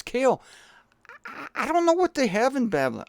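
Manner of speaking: high-pitched, apologetic, stammering messenger; Woody Allen. Acted. Messenger breaking bad news to the king, stammering Woody Allen impression. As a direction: deliver doom as a hapless, fretful go-between. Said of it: "Woody Allen miraculously sounds like this messenger".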